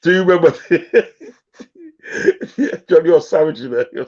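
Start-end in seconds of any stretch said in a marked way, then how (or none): 1.6-2.8 high squealing laugh. Real, not acted. Laughs hard at joke. 2.9-3.6 laughing. Real, not acted. Laughing at John's jibe.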